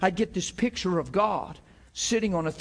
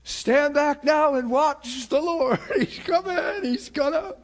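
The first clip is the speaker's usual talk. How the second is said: high, shouting mock faith; mock hammed-up Moses; 8 semitones higher, 3 dB louder, slower than usual. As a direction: ham up Moses's big proclamation. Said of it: "he does what we do".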